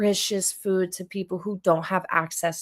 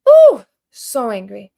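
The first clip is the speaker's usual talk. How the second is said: loud, slow burst of anger; drawn-out, loud, angry exclamation; 12 dB louder than usual. Real, not acted. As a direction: let the anger boil over on "so angry". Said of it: "I am angry".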